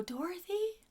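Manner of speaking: put-on small, high, questioning child voice; faint little voice. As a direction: imitate a little girl timidly asking. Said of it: "this faint little voice".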